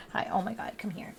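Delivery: soft whispered coaxing; hushed call to come close. Real, not acted. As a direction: whisper it to the pet. Whispering to a pet.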